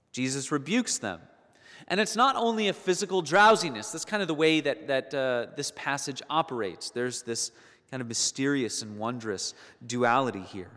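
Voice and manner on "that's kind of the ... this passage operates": usual speaking voice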